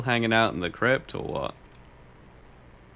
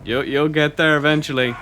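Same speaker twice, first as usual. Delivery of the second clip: loud encouragement; 7 semitones higher, 7 dB louder than usual. Real, not acted. Encouraging himself.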